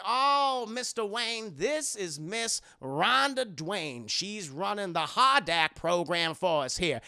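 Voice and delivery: slow, drawn-out Southern introduction; Southern accent. Acted. Rossum introducing Rhonda to Bruce, slow Southern accent. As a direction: introduce her in a leisurely Southern drawl. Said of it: "He has a very thick Southern accent".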